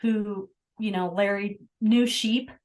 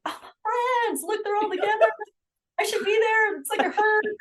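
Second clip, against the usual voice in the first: much higher, fast, cutesy lamb talking; little lamb voice; 12 semitones higher than usual. Retold or imitated. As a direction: squeak it out like a lamb who found its herd. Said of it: "that little lamb was like".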